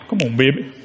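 ordinary talk

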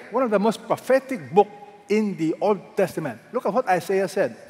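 ordinary talk, nothing marked

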